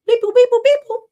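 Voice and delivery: high, loud electronic beep-boops; squeaky mock keypad tones; phone beep imitation. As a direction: mimic phone keys being tapped. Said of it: "That's me typing it in".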